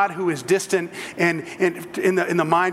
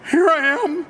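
high, earnest answer to God; reverent answer; 9 semitones higher, 3 dB louder than usual. Shown as a demonstration. Showing the congregation how to answer God, with awe. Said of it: "this is how you respond".

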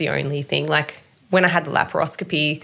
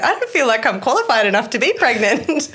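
1 mock overwhelmed; 7 semitones higher than usual.

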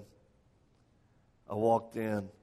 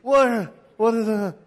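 much higher, louder jumpy panic; startled; 11 semitones higher, 11 dB louder, slower than usual. Retold or imitated. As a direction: act out being jolted awake. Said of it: "I was like".

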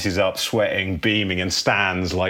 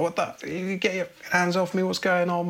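mock scream; 12 semitones higher than usual. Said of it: "He's screaming".

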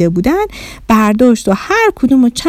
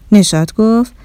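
ordinary delivery

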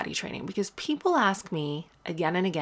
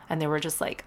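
ordinary talk

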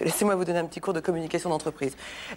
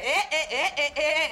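woodpecker laugh; 8 semitones higher than usual. Retold or imitated. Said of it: "Woody Woodpecker".

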